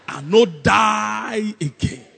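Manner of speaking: loud refusal, DIE stretched slowly; comic stubborn outburst, drawn out; Nigerian Jesus. Acted. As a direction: drag out the refusal like an offended man. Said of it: "if it was a Nigerian Jesus".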